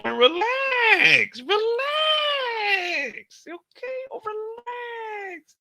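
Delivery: high crying falsetto pleading relax; a drawn-out, whiny plea to relax; mock soothing. Exaggerated soothing to viewers.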